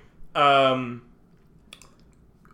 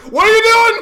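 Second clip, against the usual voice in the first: much higher, louder shout; 11 dB louder, much faster than usual. Retold or imitated. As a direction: yell it in alarm. Shocked school crowd.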